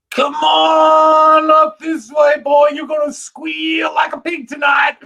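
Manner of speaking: high, loud, drawn-out mocking shout; menacing backwoods yell, half laughing; hammed-up hillbilly holler. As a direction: holler down the slope to scare him off. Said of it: "and I said".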